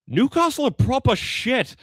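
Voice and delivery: high, loud British jeer; imitated British yob's taunt; drunk British heckler. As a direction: shout it like a drunken British fan. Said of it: "out of their mind British guys".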